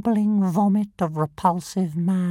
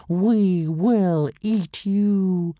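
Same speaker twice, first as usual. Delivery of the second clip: slow drawn-out threat; 4 dB louder, much slower than usual. Acted. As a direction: stretch it out slowly and menacingly.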